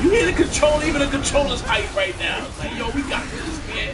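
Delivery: high-pitched excited gamer chatter; rally the player with real hype; hyped up. Real, not acted. Hyped up during the fight.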